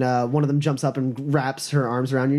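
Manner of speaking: ordinary delivery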